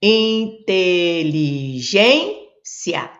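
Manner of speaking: "Inteligência" stretched very slowly, syllable by syllable; teacher sounding out "Inteligência" in drawn-out syllables. Shown as a demonstration. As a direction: hold each syllable of "Inteligência" long before the next. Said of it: "Então, aqui vai ficar aqui".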